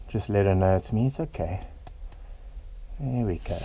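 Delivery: quiet, gentle reassurance; soft soothing to the snake. Real, not acted. Keeper calming the irritated python, soft and quiet. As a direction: murmur to settle a grumpy animal.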